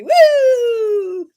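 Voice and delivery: loud high woo; excited cheer. Real, not acted. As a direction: whoop with joy. Party cheer.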